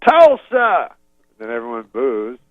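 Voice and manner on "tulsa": high loud shout; crowd-rousing yell. Retold or imitated. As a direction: call it out to a stadium. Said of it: "Then he goes".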